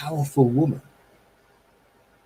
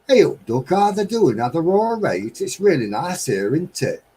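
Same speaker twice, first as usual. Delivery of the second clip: Midlands dialect; 7 semitones higher than usual. Shown as a demonstration. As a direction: chat like a local from back home. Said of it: "talk like where I come from".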